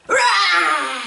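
short playful roar; dinosaur roar. Shown as a demonstration. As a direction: growl it out like a friendly dinosaur. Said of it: "what does a dinosaur say".

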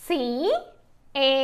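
very slow, letter by letter; spelling out. Shown as a demonstration. Teacher spelling a word for learners, slowly letter by letter. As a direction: spell it with long gaps between letters. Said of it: "ఎలా పలుకుతుందో చూద్దాము".

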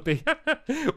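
a short high burst of laughter. Real, not acted. Joking about cheating.

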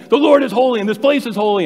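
loud, high scolding about holiness. Retold or imitated. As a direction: preach holiness at the kids. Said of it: "She just went this whole thing".

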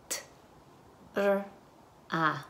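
"T, r, a" as isolated sounds, r rolled alone. Shown as a demonstration. Teacher segments tra into phonemes, sound by sound. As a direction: sound out "T, r, a" one at a time. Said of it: "Las fonemas".

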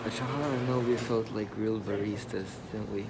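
muffled behind surgical mask; speaking under mask; through a face mask. Real, not acted. Seen 1: Both people wear surgical masks over mouth throughout the clip.

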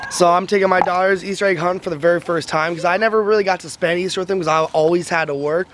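ordinary talk, nothing marked

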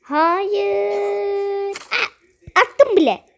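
high drawn-out whiny no; high doll voice. Acted. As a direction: whine it high like a little doll. Doll character protesting.

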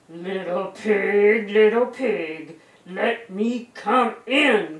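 loud, drawn-out wolf call; booming wolf voice, stretching every word; big bad wolf. A narrator's reading. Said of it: "cried the big bad wolf".

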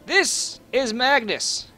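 ordinary talk

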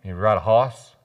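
Boston "hoss" with no r; Boston accent. Shown as a demonstration. Preacher joking in a light Boston accent. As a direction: ride a "hoss", Boston style. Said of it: "horse in Boston".

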